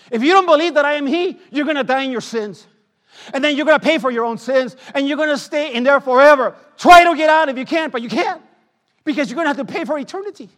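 loud, high preaching with shouted pleas; a preacher warning sinners of the lake of fire, shouting; fiery preaching. Acted. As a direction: warn them at full force. Preaching Jesus' warning loudly.

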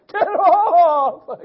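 long, high, loud holler; frantic yell from under the pile. Retold or imitated. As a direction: holler it out, stretching the last word. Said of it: "I'm yelling".

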